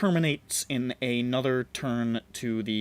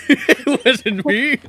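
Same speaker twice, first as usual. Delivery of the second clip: much higher, louder laughing denial; 12 semitones higher, 11 dB louder, slower than usual. Pretend. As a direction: giggle through the denial. Laughing high put-on denial.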